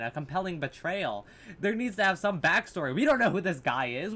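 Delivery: ordinary talk, nothing marked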